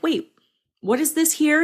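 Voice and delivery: mildly baffled, voice lifting; puzzled reader. Acted. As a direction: query it like a confused reader. Imagined reader puzzled by draft.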